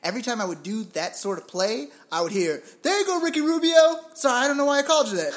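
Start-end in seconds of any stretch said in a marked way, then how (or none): 2.8-5.3 mocking a pickup player. Retold pickup player praising then apologizing.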